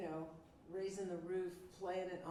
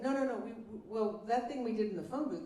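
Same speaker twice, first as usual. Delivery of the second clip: insistent; 3 semitones higher, 7 dB louder than usual. Retold or imitated. Producer insisting.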